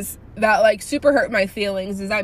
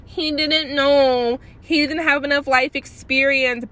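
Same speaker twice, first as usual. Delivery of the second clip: higher-pitched mimicry with drawn-out words; mock-pleading, slow, higher voice; mocking excuse-makers; 6 semitones higher than usual. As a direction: imitate people making lame excuses, pitched up. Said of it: "I hate people like".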